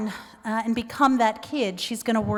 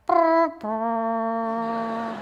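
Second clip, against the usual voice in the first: two buzzing, trumpet-like sighs through the lips; double trumpeting sigh. Retold or imitated. Mom hearing about the double-pierced ears: two trumpet sighs. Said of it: "a cross between a sigh and a trumpet playing".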